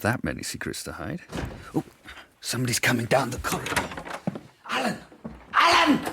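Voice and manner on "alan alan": urgent call, rising to a loud high shout. Acted. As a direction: call for Alan as someone approaches. Calling out down the corridor.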